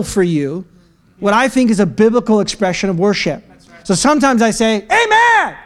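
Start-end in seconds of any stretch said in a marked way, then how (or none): 4.9-5.5 high, ringing praise shout; voice of triumph. Shown as a demonstration. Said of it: "trying to model this voice of triumph".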